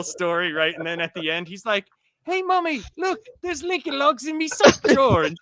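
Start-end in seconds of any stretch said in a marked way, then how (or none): 2.2-5.2 put-on high, excited little-kid voice calling out to Mommy. Retelling the comedian's bit, a kid shows Mommy his sock drawer, high excited child voice. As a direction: squeal it like a thrilled small child.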